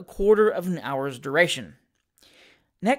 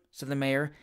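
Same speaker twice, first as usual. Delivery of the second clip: plain reading voice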